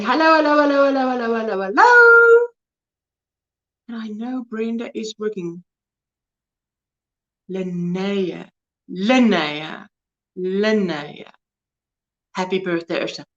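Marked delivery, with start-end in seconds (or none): 0.0-2.5 chain the hellos together, then shoot up on the last; playful repeated greeting. Retold or imitated. Host reading out Brenda's greeting, playful string of hellos. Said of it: "Brenda's saying". 7.5-11.3 low, soft repeats of Linnea as "lin-NAY-a"; practised pronunciation. Shown as a demonstration. Host rehearsing a viewer's name, Linnea softly repeated as "lin-NAY-a". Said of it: "Emphasis on the".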